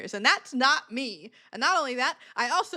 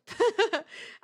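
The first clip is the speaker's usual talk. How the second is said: a quick high giggle; high laugh; 11 semitones higher than usual. Real, not acted. Laugh at coping.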